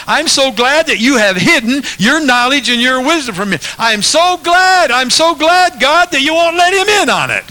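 a wildly exaggerated smug prayer; mock gloating prayer. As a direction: play a smug self-righteous pray-er. Preacher acts a gloating prayer.

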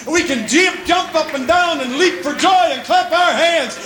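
impassioned revival-preacher climax; fervent preaching. Real, not acted. Preaching climbs to full force.

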